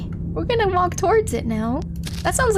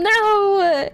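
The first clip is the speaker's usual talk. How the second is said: sudden startled no, pitched up and loud; frightened cry; 6 semitones higher, 5 dB louder than usual. Real, not acted. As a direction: recoil from the drop. Recoiling from a gaping chasm.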